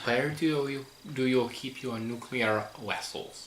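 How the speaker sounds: mock Russian accent, v turned to w; hammed-up Russian accent asking directions; Chekhov. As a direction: put on a playful movie Russian accent. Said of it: "Chekhov, Russian, trying to ask about".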